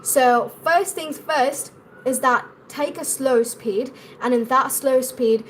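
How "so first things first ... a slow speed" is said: normal talking voice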